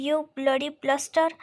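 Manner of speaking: plain reading voice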